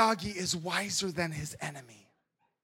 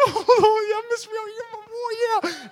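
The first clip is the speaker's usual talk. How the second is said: much higher, excited cheer; cheering kids; 12 semitones higher, 5 dB louder than usual. Retold or imitated. Kids in the theater cheering Miyagi, high excited cheer. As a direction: hype up your hero.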